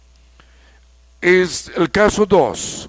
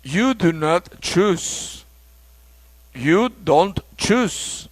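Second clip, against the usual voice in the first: very slow, word by word; slow model reading; much slower than usual. Shown as a demonstration. Teacher modeling full and contracted sentences slowly. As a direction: spell it out slowly for learners. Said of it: "slow model sentences".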